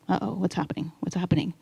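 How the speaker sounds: hushed, uneasy self-talk; softly voiced anxious thought; quiet inner alarm. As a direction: worry softly to yourself. Retold alarmed inner thought during meditation.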